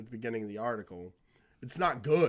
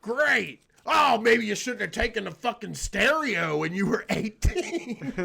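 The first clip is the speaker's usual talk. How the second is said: a hammed-up nagging parent, higher and louder; mocking parent; 8 semitones higher, 6 dB louder than usual. Mocking the parents who complain about the stereo he took. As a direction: sneer it like a scolding parent. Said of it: "That's how they act".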